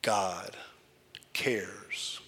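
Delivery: "cares" whispered, "for you" fading to nothing. Shown as a demonstration. Preacher comforting the room, whispered fading. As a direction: let "cares for you" fade into a breath. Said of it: "I'm gonna say it a couple times".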